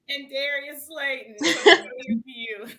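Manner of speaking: loud laughing rush. Real, not acted. Host awarding the point, loud laughing.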